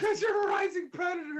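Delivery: high, loud blurted joke; shouted punchline. Real, not acted. Friend blurting the joke title in a loud high shout.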